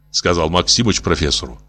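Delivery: plain reading voice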